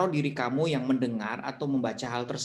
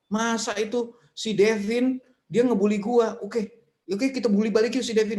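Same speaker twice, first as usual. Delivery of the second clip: put-on high, excited kid planning revenge; mock outraged kid; 8 semitones higher, 4 dB louder than usual. Teen to friends, plotting payback, high and indignant. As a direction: squeal it like an indignant teen ganging up.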